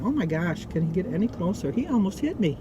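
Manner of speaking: ordinary talk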